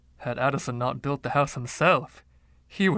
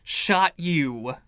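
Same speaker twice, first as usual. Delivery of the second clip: exasperated; 5 semitones higher, 3 dB louder, slower than usual. Acted. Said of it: "I became exasperated".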